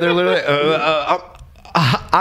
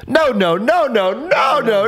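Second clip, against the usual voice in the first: imitating Milo; 12 semitones higher than usual. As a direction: protest over and over in a high voice. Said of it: "the friend who's like".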